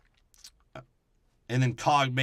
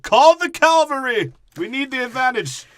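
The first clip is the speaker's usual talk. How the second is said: much higher, louder, slow grand proclamation; pompous commander; 14 semitones higher, 11 dB louder than usual. Retold or imitated. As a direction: bellow it like a hammy general. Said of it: "be like".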